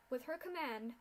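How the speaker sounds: usual speaking voice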